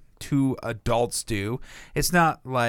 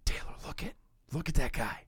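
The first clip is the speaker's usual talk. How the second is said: whisper; 7 dB quieter, faster than usual. Retold or imitated. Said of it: "Jeffrey's like".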